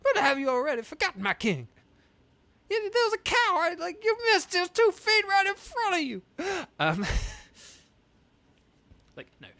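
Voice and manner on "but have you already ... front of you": put-on high, loud, fast indignant old advisor; shrill huffy protest; Muzu voice. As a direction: squawk it in outrage.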